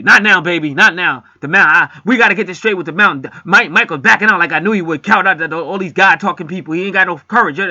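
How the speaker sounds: loud, high, heated brush-off; a man shouting down his girlfriend, too wound up to listen; angry rant. Retold or imitated. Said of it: "He was going on".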